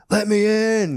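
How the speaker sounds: a woman's mock shout through the gates; mock demand at gate. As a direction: call out to be let in, half-acted.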